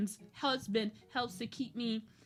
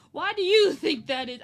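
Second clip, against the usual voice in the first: the question thrown out again, louder and pushier; louder mock question; 3 semitones higher, 9 dB louder than usual. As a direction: mimic the caseworker, louder. Caseworker's question repeated, louder.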